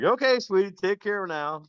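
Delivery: high, sugary call; sweet come-on. Retold or imitated. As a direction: coo it like a flirt. Quoting hookers calling out.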